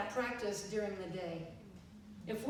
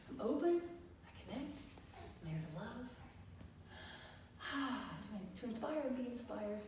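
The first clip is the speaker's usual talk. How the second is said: hushed self-reminder; tentative, soft affirmation; quiet mantra; 8 dB quieter than usual. Retold or imitated. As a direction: softly recite it to yourself, each phrase set apart. Recites mantra to herself.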